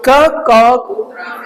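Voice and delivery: "ककॉग्राफिस्ट" slowly split: ka, kaw, grafist; syllable by syllable. Shown as a demonstration. Teacher introducing a hard word to students, slow broken-up "ककॉग्राफिस्ट". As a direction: sound out "ककॉग्राफिस्ट" with gaps between the parts. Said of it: "ये ग्र में नहीं रहेगा".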